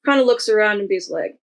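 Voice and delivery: ordinary delivery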